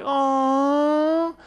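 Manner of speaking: long, high drawn-out sympathetic aw; audience awing. Retold or imitated. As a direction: imitate a cooing audience. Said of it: "the audience was like".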